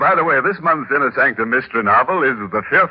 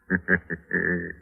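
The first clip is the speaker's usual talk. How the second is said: sinister chuckle; 3 semitones lower, 7 dB quieter than usual. Acted. Host signs off with a chuckle.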